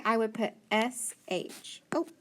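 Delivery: usual speaking voice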